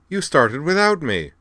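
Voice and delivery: high, loud, playful indignation; mock indignation. A narrator's reading. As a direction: protest loudly as if offended, in a light accent. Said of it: "lightly accented tone of mock indignation".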